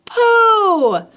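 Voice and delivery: high, loud glad call of a name; a boy's happy shout of welcome; joyful cry. Acted. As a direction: call out in delight at a friend's return. Christopher Robin sees Pooh again.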